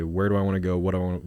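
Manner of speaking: ordinary talk, nothing marked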